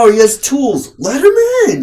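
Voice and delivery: high, loud cartoonish gatekeeper voice; mock gatekeeper. Mocking film industry gatekeepers, a high loud welcoming squeal. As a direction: squeal it out like an eager doorman.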